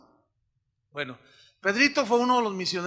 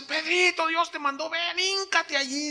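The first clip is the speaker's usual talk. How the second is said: voicing the pastor; 9 semitones higher than usual. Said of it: "me dice".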